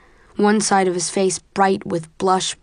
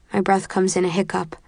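ordinary delivery, nothing marked

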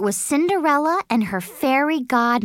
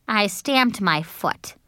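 plain reading voice

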